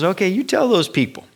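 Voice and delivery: lightly voiced anxious Moses; Moses pleading. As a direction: sound like a harried Moses passing on rules. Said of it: "Moses cried out to the Lord".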